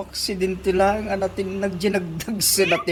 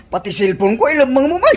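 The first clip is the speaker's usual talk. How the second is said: rising into a louder, higher complaint; loud protest; 6 semitones higher, 7 dB louder, faster than usual. Acted. Son protesting to his mother over his phone, rising loud complaint. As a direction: push back harder as you go.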